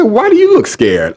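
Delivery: high, incredulous retort; exasperated high-pitched questioning; indignant challenge. Retold or imitated. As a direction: challenge them in disbelief, pitch jumping up. Retelling his indignant confrontation.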